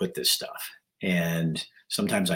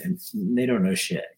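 scoffing. Real, not acted. Scoffing at critics.

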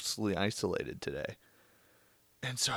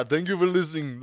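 put-on louder, higher Austrian action-hero accent; playful Austrian accent sign-off; Arnold voice; 7 semitones higher, 7 dB louder than usual. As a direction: thank the listeners as a bad Schwarzenegger. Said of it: "my Arnold impression".

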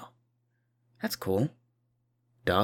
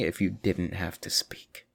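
whisper; 3 semitones lower, 5 dB quieter, slower than usual. Acted. Dreamy aside about silence.

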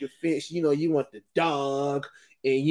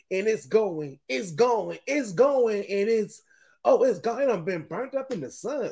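rising excited play-by-play, building higher; mock home run call; 6 semitones higher than usual. Fan calling his own imaginary homer, building excitement. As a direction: call the ball flying out like a broadcaster.

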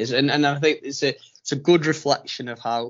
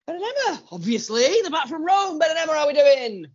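high-pitched, excited call-out greeting; excited welcome; 14 semitones higher, faster than usual. Real, not acted. Co-host calls back the returning travellers, excited welcome. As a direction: greet them in a big high voice.

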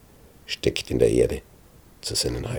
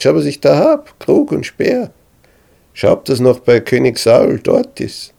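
higher, louder mocking call; taunting shout; 9 semitones higher, 6 dB louder than usual. A narrator's reading. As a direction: show off the trophies, loud. David taunts Abner across valley.

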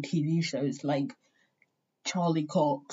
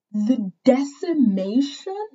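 hardcore emphasis; 6 semitones higher, 3 dB louder, slower than usual. Real, not acted. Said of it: "How fucking hardcore does that sound?".

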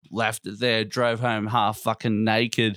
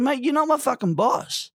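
retold retort; 14 semitones higher than usual. Retelling own retort.

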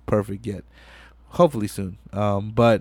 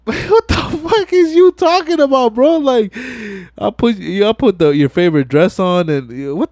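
incredulous Brian; 8 semitones higher, 4 dB louder than usual. Retold or imitated. Brian confronts Vince's insult.

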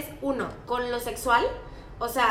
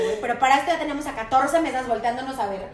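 ordinary delivery

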